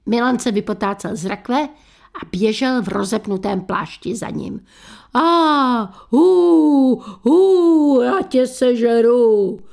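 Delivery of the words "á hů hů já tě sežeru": loud, high, drawn-out monster howl; slow booming spook threat; menacing ghost. A narrator's reading. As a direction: wail and threaten to devour him. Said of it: "hrozil co roztaženýma rukama".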